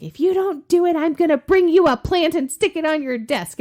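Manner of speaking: high, loud teasing threat; playful threat. Retold or imitated. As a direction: tease them with a mock threat.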